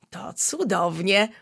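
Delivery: usual speaking voice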